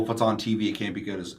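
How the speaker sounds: usual speaking voice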